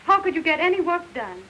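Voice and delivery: wife calls to husband over the noise. Acted. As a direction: call out sympathy over the din. Shouting over hammering noise.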